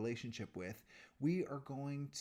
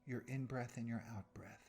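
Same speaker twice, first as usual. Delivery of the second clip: guided meditation. Real, not acted. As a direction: guide them unhurriedly through each breath, softening at phrase ends.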